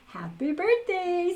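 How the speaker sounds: high, loud, slow snowman greeting; cheerful birthday from Frosty. A narrator's reading. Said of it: "said Frosty again".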